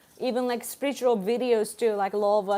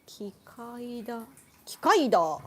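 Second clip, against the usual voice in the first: dawning realization. Real, not acted. Streamer working out a superhero's name for a viewer, dawning realization. Recognition dawns on the word.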